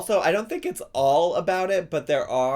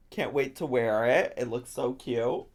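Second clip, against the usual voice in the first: ordinary delivery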